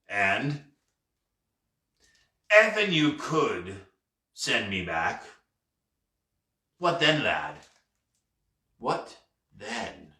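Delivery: pirate slowly leading someone on, with pauses between phrases; sly, carefully spaced words. A narrator's reading. Pirate prodding the lad over his shoulder, careful and sly. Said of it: "spacing his words carefully and slyly".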